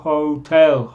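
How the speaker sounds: normal talking voice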